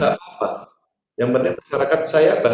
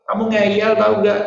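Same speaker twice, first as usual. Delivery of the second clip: mock scolding; 6 semitones higher than usual. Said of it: "Saya bilang gitu".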